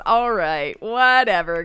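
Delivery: normal talking voice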